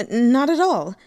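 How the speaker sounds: a weak, uneasy laugh into the words. A narrator's reading. Demon woman brushing off the venerable's question with a weak laugh. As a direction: laugh it off nervously. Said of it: "said with a weak laugh".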